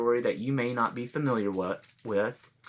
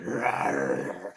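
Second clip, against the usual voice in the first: low, rough mock dog growl; 7 semitones lower, 3 dB quieter than usual. Sassy dog teasing Alligator, low growl. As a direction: growl it like a dog, deep in the throat. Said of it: "He growled".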